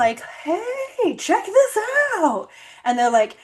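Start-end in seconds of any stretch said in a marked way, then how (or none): 0.4-2.5 high, eager show-off call; chirpy playful imitation; mock excited. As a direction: pitch it way up like an excited kid. Mock descriptivists showing off.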